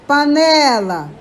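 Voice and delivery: "Panela" slow, leaning on "ne"; stress on middle syllable. Shown as a demonstration. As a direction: draw out the "ne" in "Panela", falling after it. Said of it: "o né".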